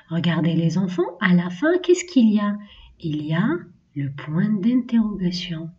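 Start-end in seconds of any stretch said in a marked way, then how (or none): none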